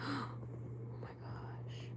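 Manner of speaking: barely voiced, hushed shock; shocked whisper. Real, not acted. Streamer reading grim news, shocked whisper. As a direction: whisper it to yourself in disbelief. Shocked by what she reads.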